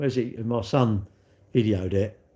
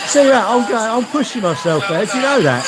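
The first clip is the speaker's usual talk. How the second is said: excited, loud; 6 semitones higher, 7 dB louder than usual. Real, not acted. Excited watching own race video.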